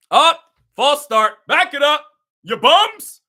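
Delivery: loud, high fan yelling at the screen; furious yelling. Real, not acted. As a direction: bellow at the players in disgust. Fan yelling at TV over penalty.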